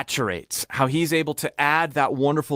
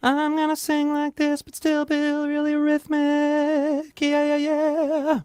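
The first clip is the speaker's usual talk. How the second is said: high sung head voice; 9 semitones higher, slower than usual. Shown as a demonstration. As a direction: float it up in head voice. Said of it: "almost a head voice".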